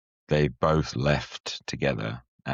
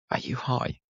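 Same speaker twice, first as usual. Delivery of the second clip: incredulous question; 11 semitones higher than usual. Retold or imitated. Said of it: "I was just looking at them going".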